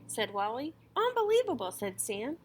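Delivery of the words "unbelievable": high, loud amazed gasp of a word. A narrator's reading. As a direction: blurt it out in disbelief. Shopkeeper amazed.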